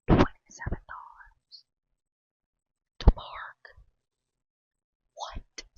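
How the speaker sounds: stunned disbelieving whisper. Real, not acted. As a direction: whisper in outrage. Outraged whisper about parking price.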